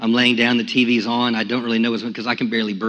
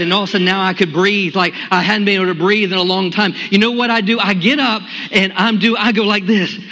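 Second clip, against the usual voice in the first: much higher, louder, bursting with joy; ecstatic praise; 9 semitones higher, 3 dB louder than usual. Real, not acted. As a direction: let the joy of breathing again explode. Sudden joy, praising and dancing.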